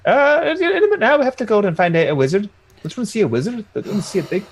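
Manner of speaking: high, loud granny voice, settling as it goes; Auntie Nanny in character. Acted. Nanny putting off an impatient kid, high flustered voice. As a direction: stall the child in a high-pitched character voice.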